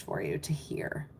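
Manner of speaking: unvoiced whisper to the chat. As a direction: whisper it like a secret.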